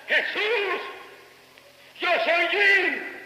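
high, long-drawn acted cry; retold cry. Preacher voices Jim on another dawn at the altar, retold cry. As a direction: stretch the imitated cry out high to the end. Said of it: "volvió a gritar otra vez".

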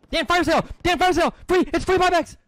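loud, high callout to a teammate; shouting. Real, not acted. Gamer yelling fire sale to teammate Dan, loud shout. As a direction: yell it across the game so Dan hears.

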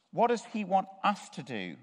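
usual speaking voice

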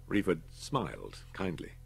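usual speaking voice

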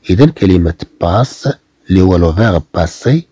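usual speaking voice

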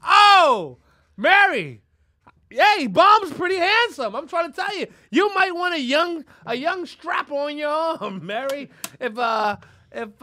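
loud, high, drawn-out appeal; mock calling out. Host pitches his friend to [name], mock calling out. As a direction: holler a hammed-up plea to a star.

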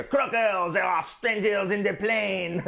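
a lightly voiced talking standing stone; talking rock voice. Reviewer tries a voice for the talking stones. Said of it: "I got various voices for them".

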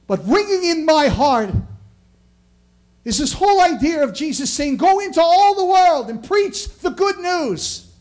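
high, loud, ringing preacher's cry; full-force preaching. Acted. Pastor preaching to the congregation, full-force sermon. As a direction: lift the room, hammer each phrase like a revival preacher. Proclaims Jesus' great commission.

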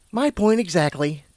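lightly acted unicorn making her point; exasperated unicorn. A narrator's reading. Purple unicorn answering Lumina, lightly exasperated.

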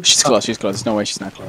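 hiding from the monster; frightened whisper. Real, not acted.

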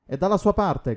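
high, loud warning cry; shouted warning. A narrator's reading. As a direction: shout it out to alert him. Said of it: "gridò Hooper".

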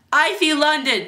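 high, loud playground taunt; jeering. Acted. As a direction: tease him for all to hear. Kids jeer at boy.

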